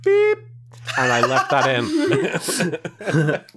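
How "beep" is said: high, loud vocal "beep"; imitated censor bleep. Editor faking a censor sound effect, high loud beep. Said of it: "so I just said".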